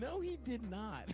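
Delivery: hammed-up indignant woman; woman on the date. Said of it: "same woman".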